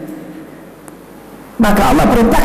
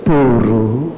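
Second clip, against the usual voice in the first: normal talking voice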